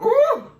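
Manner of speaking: laughing into a loud ooh; laugh then high ooh. Real, not acted. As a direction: laugh and yelp at the burn. Reacting to spicy heat.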